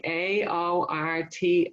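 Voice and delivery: very slow, each letter A O R T set apart; spelling it out letter by letter. Retold or imitated. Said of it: "she's like".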